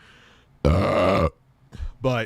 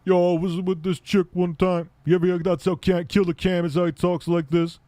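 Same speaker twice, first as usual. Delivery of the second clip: imitating Cam; 7 semitones higher, 4 dB louder than usual. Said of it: "And he was like".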